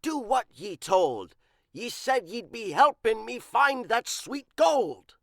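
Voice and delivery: loud, gruff pirate demand; barking. A narrator's reading. Big Pete barking at the librarian, angry pirate. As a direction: bark it at her, fed up. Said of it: "he barked at Lou".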